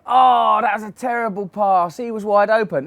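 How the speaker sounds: high, loud armchair critic; mock fan. Fan yelling at the telly, high and loud. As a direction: complain like a fan on the sofa. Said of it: "you go".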